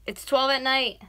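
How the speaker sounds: loud, high, insistent; yelling. Real, not acted. Girlfriend nagging boyfriend up at night, yelling. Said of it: "I yell at him like that to get up".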